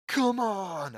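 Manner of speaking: loud, high urgent shout; rally everyone to rush along; shouted urging; exclaiming. A narrator's reading.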